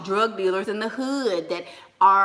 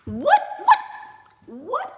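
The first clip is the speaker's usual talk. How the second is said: surprised outburst; 13 semitones higher than usual. Real, not acted. Streamer spots someone in the chat, surprised outburst. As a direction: squawk it in disbelief.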